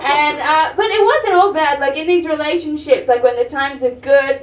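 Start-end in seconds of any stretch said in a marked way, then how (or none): none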